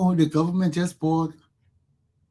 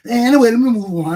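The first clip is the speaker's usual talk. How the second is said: ordinary talk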